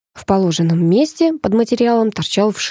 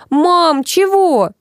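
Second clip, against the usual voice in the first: put-on little girl; 10 semitones higher, 3 dB louder, much slower than usual. A narrator's reading. Little girl popped out asks mother.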